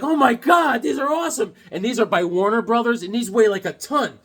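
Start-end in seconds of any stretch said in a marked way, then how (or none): 0.0-1.5 high, gushing delight; retold excitement. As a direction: act out being thrilled, pitched way up. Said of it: "I was like".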